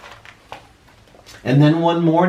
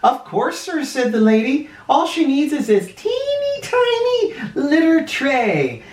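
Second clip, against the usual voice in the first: lightly voiced high shop lady, sweet and eager; a hint of a chirpy saleslady selling a pet; 12 semitones higher than usual. Shop lady to the old man, lightly high and chirpy.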